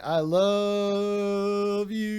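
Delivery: very slow, drawn-out "I love you" in a far-off mocking voice; mock romantic. Streamer mocking a lovesick message, slow and drawn out. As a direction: stretch it out like a sappy lover.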